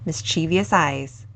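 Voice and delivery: ordinary delivery, nothing marked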